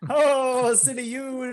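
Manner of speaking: put-on high-pitched, evasive German accent. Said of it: "so that I don't lose the accent".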